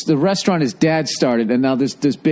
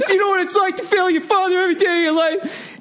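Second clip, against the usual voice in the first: squealed, very high mock sobbing plea; mock crying; faster than usual. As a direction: wail it like a big man in tears. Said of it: "He starts crying".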